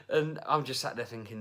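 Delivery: ordinary talk, nothing marked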